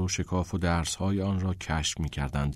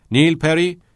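calling roll; 8 semitones higher, 7 dB louder than usual. A narrator's reading.

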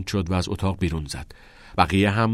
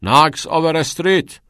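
loud, high summoning call; shouted full name, impatiently; 9 semitones higher, 8 dB louder than usual. A narrator's reading. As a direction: summon a student down the hall.

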